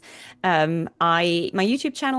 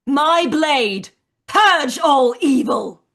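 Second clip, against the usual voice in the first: loud, high heroic shout; rally the blade with a war cry; battle cry; 8 semitones higher, 8 dB louder, much slower than usual. Acted. Live line read, battle cry.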